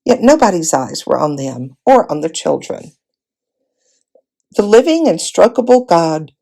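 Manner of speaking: ordinary delivery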